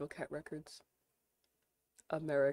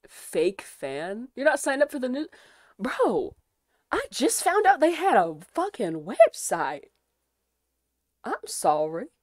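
defensive outburst; 6 semitones higher, 11 dB louder than usual. Real, not acted. Defensive mock outrage.

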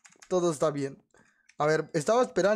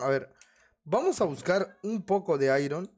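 ordinary talk

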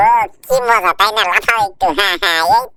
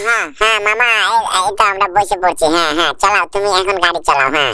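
much lower cartoon voice, eager to go; deep buddy voice egging him on; low, easygoing cartoon sidekick; 10 semitones lower, faster than usual. Sidekick agrees to ride along.

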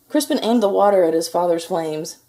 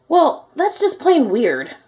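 slow, high and loud baffled knight voice; an amazed knight scratching his head; puzzled knight; 9 semitones higher, 6 dB louder, slower than usual. Acted. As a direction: draw it out, truly baffled. Knight puzzled by beach balls.

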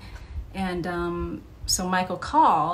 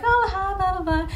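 light breezy Michael; 13 semitones higher, 4 dB louder than usual. Retold or imitated. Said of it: "usually he was like".

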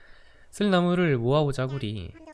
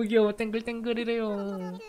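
cutesy voice; 7 semitones higher than usual. Retold or imitated. Repeating villager's cute word.

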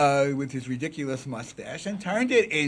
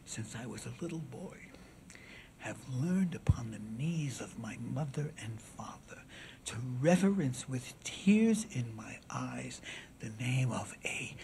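classical oration; 3 semitones lower, 7 dB quieter than usual. Acted. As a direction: address the jury gravely with long pauses. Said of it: "was classical oration".

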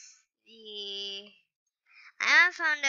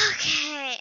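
normal talking voice

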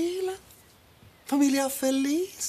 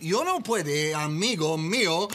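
ordinary talk, nothing marked

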